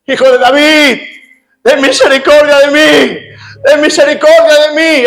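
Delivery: high, loud cry for mercy; desperate shouted plea. Retold or imitated. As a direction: cry out insistently over the crowd, imitating a blind beggar. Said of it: "él seguía gritando". (grito de súplica, agudo e insistente)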